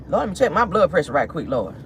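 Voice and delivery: ordinary talk, nothing marked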